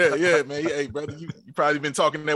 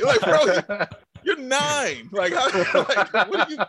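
high, laughing, can't believe it; incredulous laugh through the words; amused shock, pitched up; laughing disbelief; 7 semitones higher than usual. Retold or imitated. Disbelief at nine-year-old's deep voice.

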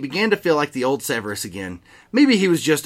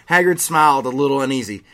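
plain reading voice

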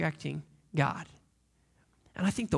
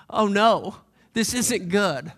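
loud, alarmed oh no, pitched up; loud alarmed cry; 3 semitones higher, 9 dB louder, much slower than usual. Retold or imitated. Driver spotting danger on the road, alarmed loud cry. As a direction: act out the panic of a passing driver. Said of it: "And I'm".

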